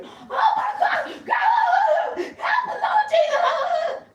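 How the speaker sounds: drawn-out wailing shriek, very high, laughing; over-the-top screaming. Retold or imitated. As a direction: wail it slow like the world is ending. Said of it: "We gonna be screaming".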